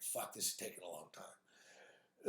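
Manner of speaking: impatient under-the-breath grumble; impatient mutter. Retold or imitated. Stuck waiting for the elevator, grumbling quietly to himself. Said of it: "I'm like".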